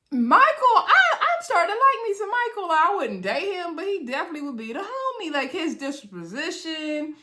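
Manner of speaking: high, fond and delighted about a new favourite; gushing over Michael. Real, not acted. Vlogger gushing to viewers about Michael, high and delighted.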